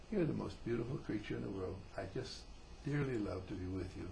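soft, low, tender declaration; sincere. Acted. Man with his wife in his arms, sincere and tender. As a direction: say it sincerely and softly, as an adult. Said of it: "a very sincere kiss".

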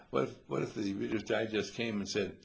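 usual speaking voice

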